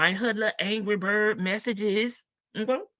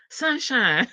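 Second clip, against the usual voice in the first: higher-pitched country twang; 4 semitones higher than usual. Acted. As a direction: say it in a country accent. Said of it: "that's my real country voice".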